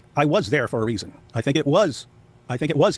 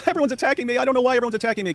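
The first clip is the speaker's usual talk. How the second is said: much higher whiny mock self-pity; mock him playing the victim; victim Sam; 9 semitones higher, faster than usual. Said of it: "you get victim Sam".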